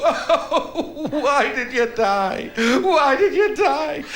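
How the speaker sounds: slow, high hammed-up wail of grief; mock sobbing. Mourner wailing over a grave, hammed-up slow sobbing. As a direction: overdo a graveside lament, slowly. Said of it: "sobbing his heart out".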